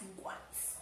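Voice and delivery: iguais whispered softly after the slow take; whispered echo. Shown as a demonstration. Teacher to pupils, whispering iguais again. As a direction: breathe iguais as a quiet echo.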